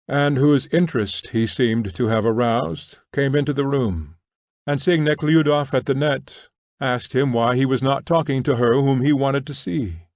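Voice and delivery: ordinary delivery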